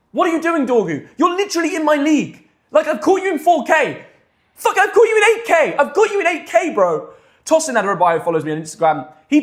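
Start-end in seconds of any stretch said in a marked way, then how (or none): 0.1-7.1 high-pitched playful indignation; mock outrage. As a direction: call him out in rising mock disbelief. Calls out a player mock-indignantly.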